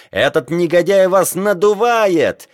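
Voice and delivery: high-pitched accusation; indignant yell. A narrator's reading. Geographer exposing the captain to sailors, yelling. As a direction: accuse him in a high, outraged cry. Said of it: "орал Леруа".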